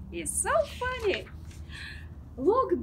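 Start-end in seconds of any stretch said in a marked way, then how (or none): none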